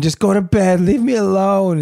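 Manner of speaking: high-pitched mock wail; retold crying scream. Husband quotes crying wife sending daughter away, high mock wail. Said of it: "screaming, crying, saying".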